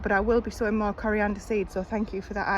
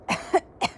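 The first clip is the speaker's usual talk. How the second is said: harsh real cough; coughing up a fly; 4 dB louder than usual. Real, not acted. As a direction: cough to clear the throat. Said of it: "It doesn't want to be swallowed".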